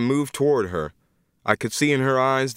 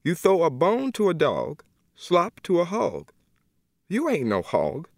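much higher, firm refusal; a godmother drawing the line; Miss Emma refusing; 7 semitones higher than usual. Acted. Emma pleading.

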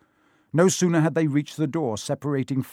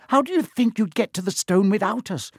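brisk, higher-pitched practical voice; businesslike and pitched up; 7 semitones higher than usual. A narrator's reading. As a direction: take charge in a no-nonsense way. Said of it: "said Hermione briskly".

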